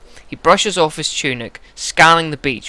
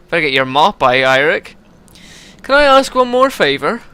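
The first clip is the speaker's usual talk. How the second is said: lightly voiced character, pitched a bit up; touch of a put-on voice, rising on the plea; game character; 6 semitones higher, 5 dB louder than usual. Character asking Eirik for one more favour, lightly voiced.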